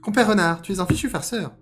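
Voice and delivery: high, loud cartoon rabbit; hard-to-do rabbit voice. Rabbit denouncing fox as a trickster, high loud voice. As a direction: declare it loudly in a squeaky pitch. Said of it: "déclara le lapin".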